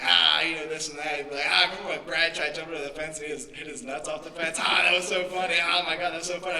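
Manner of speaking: loud, giddy bro reminiscing; mock dumb teen buddies. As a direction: ham up a teen laughing with his friends.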